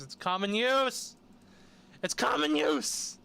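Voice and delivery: slow, high-pitched yell, rising in intensity; exasperated, drawn-out shout; exasperated outburst. Real, not acted. Exasperated rebuttal of the quote.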